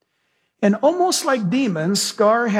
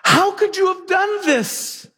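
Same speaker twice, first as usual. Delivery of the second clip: breathy then sharply higher, slow fake dismay; Scar's false pity; 11 semitones higher, slower than usual. Scar blaming young Simba after the king dies, in mock horror. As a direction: feign shock to pin the blame on Simba. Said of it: "Scar turns right then and there and says".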